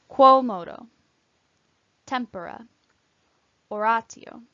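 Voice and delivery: "Quomodo, tempora, oratio" each said alone with held long O; careful Latin long O in "Quomodo" and "oratio"; long O demonstration. Shown as a demonstration. Teacher modelling long O for English speakers, careful Latin vowels. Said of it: "each long O is marked".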